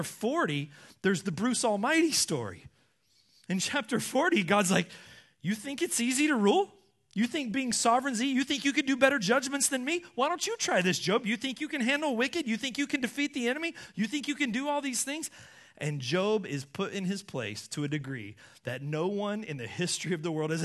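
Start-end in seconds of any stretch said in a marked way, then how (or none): 5.4-15.3 higher-pitched rapid-fire rhetorical questions; taunting challenge. Acted. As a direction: keep daring him, question upon question. God challenging Job.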